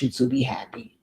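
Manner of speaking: soft unvoiced whisper of reassurance. Real, not acted. Whispering comfort to the letter writer. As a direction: breathe it out as a gentle whisper.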